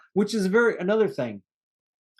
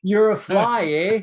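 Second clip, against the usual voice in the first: put-on Canadian accent; 4 semitones higher, 6 dB louder, slower than usual. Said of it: "bad impersonations and accents".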